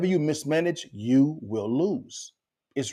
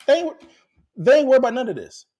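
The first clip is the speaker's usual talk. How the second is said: excited; 12 semitones higher, 6 dB louder, faster than usual. Real, not acted. Excited point about the wealthy.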